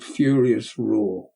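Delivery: usual speaking voice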